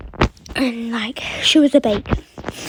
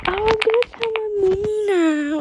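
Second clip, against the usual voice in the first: mock crying; 7 semitones higher than usual. Girl starts crying and threatens to tell mummy, imitated tearfully. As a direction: put on a tearful whine, pitched right up. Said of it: "she started crying".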